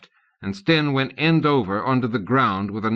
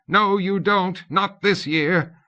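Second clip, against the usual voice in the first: shouted; 10 semitones higher, slower than usual. A narrator's reading. Tutor shouting at the charging children, playful defiance. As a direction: roar it back at the charging kids. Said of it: "Loren shouted".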